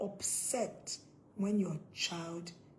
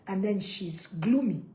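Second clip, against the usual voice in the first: ordinary talk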